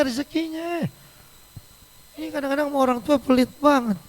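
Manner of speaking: ordinary talk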